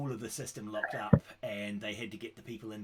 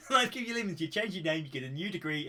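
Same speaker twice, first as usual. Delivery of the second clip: laughing; 5 semitones higher, 6 dB louder, faster than usual. Real, not acted. Laughing through his own punchline.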